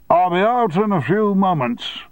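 shouting. Acted. Boss shouting over the shredder noise, curt. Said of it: "he shouted above the noise".

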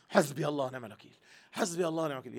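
fast, bitter imitation of an angry curse; spiteful mock curse. Teacher imitating wives who fling it at husbands, fast spite. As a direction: imitate people hurling it as a curse, quickly. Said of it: "أصل الناس بتعملها كده".

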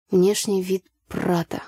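ordinary delivery

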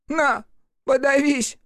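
very high squeaky snarl; spiteful imp. A narrator's reading. Imp flings the coat at Рух in a spiteful squeal.

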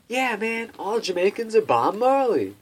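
loud, high-pitched dopey enthusiasm; mock naive wonder. Man parodies his old idea of Jamaica, mock naive wonder. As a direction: gush it big, slowing on "magical fairyland".

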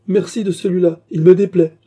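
voix plus haute et plus forte, rieuse et moqueuse; lancez-le en riant, bien plus haut et plus fort. A narrator's reading. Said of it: "fit Baccarat en riant". (moquerie rieuse, aiguë et forte)

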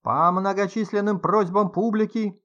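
high, slow nasal huckster patter; lottery ticket seller voice. A narrator's reading. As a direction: drone it out like a street lottery vendor. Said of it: "голосом продавца лотерейных билетов загундосил". (гнусавый голос продавца лотерейных билетов)

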